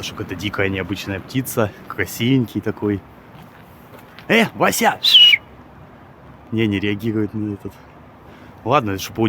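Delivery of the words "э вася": calling out. Real, not acted. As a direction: hail it like a pet. Calling out to the bird.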